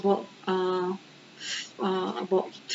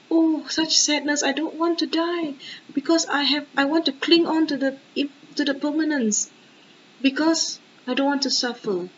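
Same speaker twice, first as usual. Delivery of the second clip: higher-pitched, put-on plaint of a dying flower; mock lamenting flower; 4 semitones higher than usual. Teacher voices a flower that fears dying, mock lament. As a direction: act out a flower clinging to life. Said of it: "Does the flower say".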